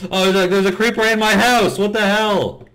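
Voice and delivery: high, loud panicked shriek; startled yelp. Real, not acted. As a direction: yelp in sudden alarm. Finds creeper in his house.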